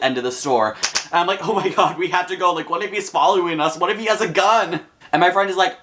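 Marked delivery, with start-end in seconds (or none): 1.5-4.8 high-pitched, urgent retold fright; acted panic. Teen to her friend while fleeing a stranger, panicky. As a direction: re-enact panicking, pitched up throughout.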